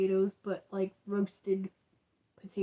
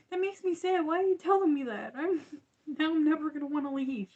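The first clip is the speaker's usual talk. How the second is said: higher, faster mock complaint; mock dismay; 7 semitones higher, 3 dB louder, faster than usual. To her mom about the waiting dog, higher playful mock whine. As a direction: playfully protest being made sad. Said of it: "And I'm like".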